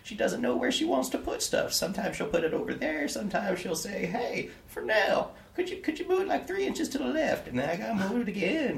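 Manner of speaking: put-on high, thin voice of a hunched old servant; old gentleman. Old servant thanking the player, high and meek. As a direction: play the bent old gentleman, humble and grateful.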